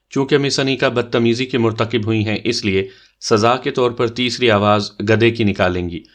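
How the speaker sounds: usual speaking voice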